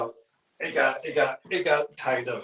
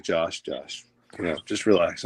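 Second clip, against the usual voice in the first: soothing brother; 7 semitones lower than usual. Retold or imitated. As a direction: hush him like a big brother settling him down. Brother calming him at the game.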